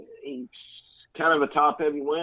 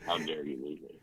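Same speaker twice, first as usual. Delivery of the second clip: a near-whisper of wounded coach, much softer; quiet, mock-hurt aside; hushed mock betrayal; 7 semitones lower, 15 dB quieter than usual. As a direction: mutter the line like a sulking old coach.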